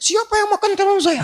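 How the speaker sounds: high shrill shout of the wife; shrieking wife. Acted. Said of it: "dia teriak". (istri berteriak dengan nada tinggi)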